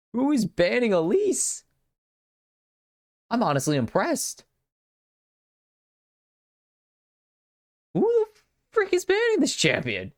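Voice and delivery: high, stretched disbelief with a laugh; baffled who would ban her; incredulous. Real, not acted. Caster baffled by Elise bans, pitch high and incredulous.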